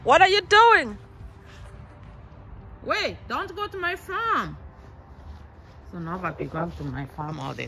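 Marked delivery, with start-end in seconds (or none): 0.0-4.6 loud and high, calling the dog from a distance. Real, not acted. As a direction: shout across the yard so the dog stops. Shouting at dog Nova outside.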